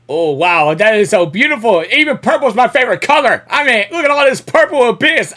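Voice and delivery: a fan thrilled by a purple landscape, gushing loudly; excited awe. Real, not acted. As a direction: gush over how beautiful it is. Awed by the purple Abyss.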